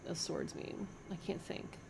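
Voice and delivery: whispered aside, flustered; whispering to herself. Real, not acted. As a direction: breathe it to yourself in a fluster.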